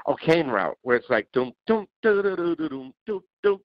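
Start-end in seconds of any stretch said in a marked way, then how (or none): 1.3-3.6 hummed beat, rising on doom. Retold or imitated. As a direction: hum the beat with your voice. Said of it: "like this".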